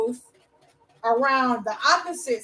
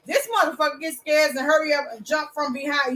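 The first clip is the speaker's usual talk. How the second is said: normal talking voice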